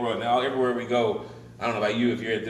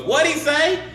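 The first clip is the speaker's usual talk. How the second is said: high, loud shouted question; loud, high-pitched deaf old-joke wife; hard-of-hearing wife; 9 dB louder, slower than usual. Acted. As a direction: yell it like you can't hear.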